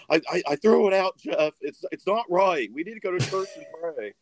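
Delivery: high-pitched imitation of a stammering grandma; flustered grandma. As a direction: play a grandma pleading in distress. Grandma's flustered answer to angry grandson.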